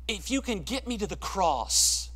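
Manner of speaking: ordinary talk, nothing marked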